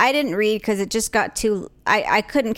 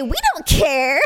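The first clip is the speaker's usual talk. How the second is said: mock shout; 4 semitones higher than usual. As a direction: yell it in jest, half-laughing. Mock shouting what they won't say.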